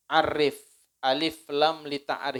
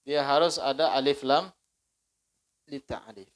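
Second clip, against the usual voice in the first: ordinary delivery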